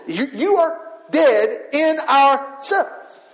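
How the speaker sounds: ordinary delivery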